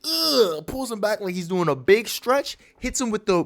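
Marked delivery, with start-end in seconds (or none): 0.0-0.6 high mock straining grunt; effort grunt. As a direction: grunt as if hauling someone back. Acts out pulling him back.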